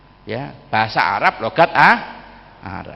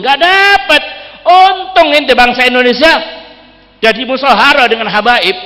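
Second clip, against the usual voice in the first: much higher, louder impassioned sermon peak; fervent preaching; 13 semitones higher, 8 dB louder than usual. Acted. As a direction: proclaim it proudly to the congregation. Preacher exalting Indonesian-habaib bond.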